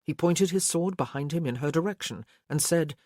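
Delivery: ordinary delivery, nothing marked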